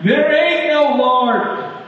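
high, slow, loud scornful shout; atheist shouting back. Retold or imitated. Annoyed atheist neighbor shouting back at grandma, high mocking yell. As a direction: yell it back across the yard. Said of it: "he started to shout back".

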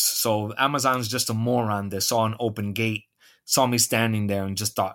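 ordinary delivery

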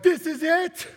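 high, loud mock elation; hammed-up excitement. Group's acted joy at a convert.

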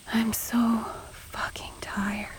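slow, weary whisper; tired slow whisper under her breath. Acted. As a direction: breathe it out, worn out. Exhausted aside, whispered.

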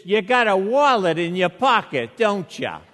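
put-on loud, accusing Revere accent. Security guy questions traveler about his wallet, loud Revere accent. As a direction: challenge him in a thick Revere accent. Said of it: "had an accent a lot like mine".

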